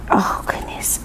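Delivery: breathy whisper of awe. Real, not acted. Testing pens for viewers, awed whisper. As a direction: hush it out in awe.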